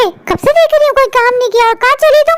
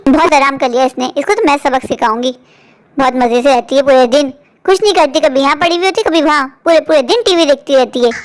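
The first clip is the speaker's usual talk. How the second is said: spiteful; 10 semitones lower than usual. Acted. Stepmother mutters plans.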